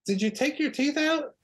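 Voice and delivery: hammed-up disbelief at the missing teeth; incredulous question. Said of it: "And I go".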